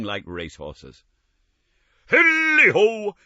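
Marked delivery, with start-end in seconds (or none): 2.1-3.1 loud, high, slow hearty whoop; jovial cry. Acted. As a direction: whoop like a jolly old boss. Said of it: "cried old Fezziwig".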